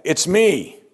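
"me" pitched high and punched; emphatic admission. Acted. Jonah owns up to causing the storm, high emphatic "me". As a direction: hit "me" hard with a high, falling tone. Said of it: "That's the way he says it".

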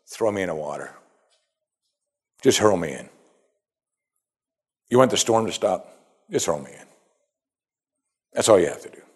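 low, matter-of-fact instruction; straightforward. Acted. Jonah tells the sailors how to stop the storm, low matter-of-fact. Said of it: "here he's honest and straightforward".

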